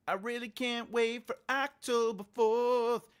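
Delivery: high, loud, giddy sing-song; bubbling over with excitement; excited. Real, not acted.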